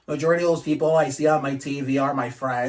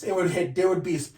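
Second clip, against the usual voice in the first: usual speaking voice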